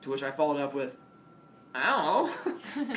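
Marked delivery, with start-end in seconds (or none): 1.8-2.9 laughing. Retold or imitated. Retold sheepish answer to cops.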